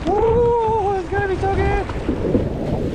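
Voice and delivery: high, drawn-out elated shout; excited whooping. Real, not acted. So fired up for two days on the river, excited whooping.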